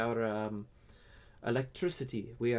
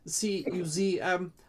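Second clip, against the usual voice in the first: lightly higher, hesitant creature voice; timid kobold; 5 semitones higher than usual. Acted. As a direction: stammer shyly like a small creature.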